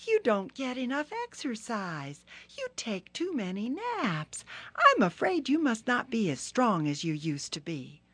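high, needling turtle voice; sly Turtle. Acted. Turtle goading Rhino about his naps, high and sly. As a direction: tease him slyly, high.

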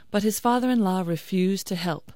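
usual speaking voice

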